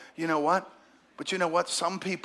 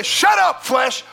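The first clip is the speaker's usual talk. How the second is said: much higher, loud "Shut up, flesh"; shouted rebuke; 11 semitones higher, 8 dB louder, slower than usual. Retold or imitated. Pastor rebuking his own flesh, shouting high and loud. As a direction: bark it at your own flesh. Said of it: "and I say".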